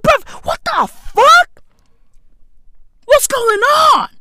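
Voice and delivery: high, drawn-out incredulous cry; exasperated disbelief. Retold or imitated. Retelling his shock at the pacing bird.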